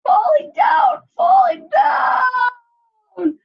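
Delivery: very high, loud, stretched-out cry of falling; panicked shouting. Acted. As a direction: wail it as you tumble. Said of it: "came a cry from above".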